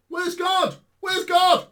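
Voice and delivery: high mock shout of a scoffer; mocking skeptics. Preacher mocking unbelievers who ask for God, shouted. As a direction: throw the doubters' cry back at them. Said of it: "People say".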